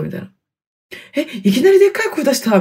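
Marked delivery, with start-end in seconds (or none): 1.1-2.6 「いきなりでっかい声出した？」 asked higher and quickly, in disbelief; mock surprise. To listeners, replaying her own reaction to her friend, in mock disbelief. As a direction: echo it back as if it's news to you.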